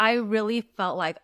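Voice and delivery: ordinary delivery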